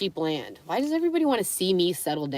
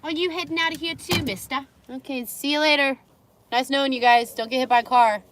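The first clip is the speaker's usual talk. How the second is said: calling out; 6 semitones higher, 4 dB louder than usual. Real, not acted. Calling to the dogs running off.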